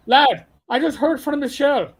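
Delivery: lightly voiced Irish boss; Irish brogue. Old CO calling out of the blue, light Irish brogue.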